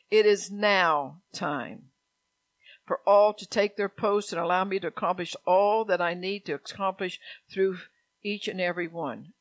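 emphatic rereading. Real, not acted. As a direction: proclaim it deliberately, loud on the opening phrases. Rereads prophecy with deliberate emphasis.

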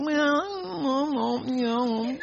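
soft, mumbled, drawn-out whine; mock whining. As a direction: imitate a mealy-mouthed whiner dragging the words. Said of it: "They stand there and whine at you".

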